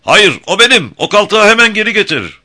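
very high, loud angry yell; furious shout. A narrator's reading. Colonel Kaiser demanding the woman back, shouting furiously. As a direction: bellow the order in rage, pitch soaring. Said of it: "Kaiser'ın sesi öfkeyle boğuklaşmıştı".

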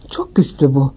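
ordinary delivery

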